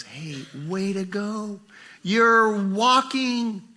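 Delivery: proud parent praising first steps; encouraging parent. Retold or imitated. Preacher voices an encouraging parent.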